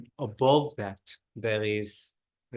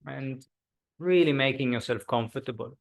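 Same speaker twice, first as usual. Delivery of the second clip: guided meditation. Acted. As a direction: ease the group into stillness. Leads a body scan meditation.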